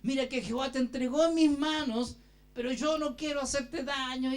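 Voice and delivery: retold shout, booming on the name; calling out. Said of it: "y le gritó".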